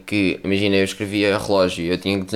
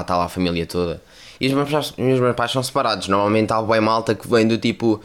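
normal talking voice

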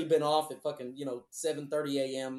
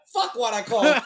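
exasperated outburst; 7 semitones higher, 11 dB louder than usual. Retold or imitated. Caught out, regretting the call.

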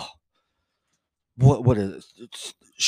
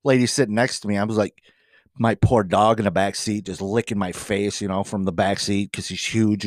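usual speaking voice

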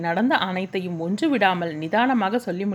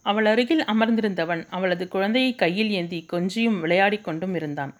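usual speaking voice

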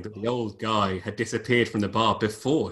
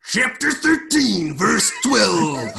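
loud, high, drawn-out wrestler voice; big slow macho bellow; Randy Savage; 11 semitones higher, 7 dB louder, much slower than usual. Acted. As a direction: shout it out like a hammed-up wrestling promo. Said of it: "Randy Savage's voice".

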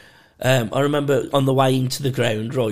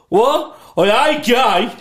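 loud, high defensive yell; mock indignant shout; 8 semitones higher, 6 dB louder, much slower than usual. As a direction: shout it like a panicky lad. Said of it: "just shouting".

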